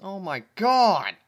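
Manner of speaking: loud, slow, high outcry; horrified shout. Real, not acted. Reader shouting in horror at the fanfic.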